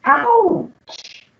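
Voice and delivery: a hammed-up little cry of hurt; mock yelp of pain. Servant stung by the reproach.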